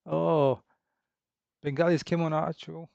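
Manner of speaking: ordinary talk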